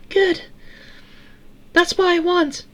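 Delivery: very high, tinny falsetto; Eleanor girl voice. A narrator's reading. Eleanor offering to grant Sarah's wishes, tinny little voice.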